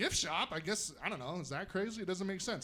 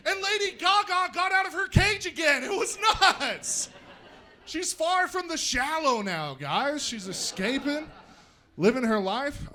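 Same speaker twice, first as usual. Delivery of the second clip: excited zoo report; 6 semitones higher, 5 dB louder than usual. Acted. First take of the [name] line.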